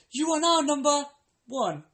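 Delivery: high, sing-song recorded voice; mock hold message.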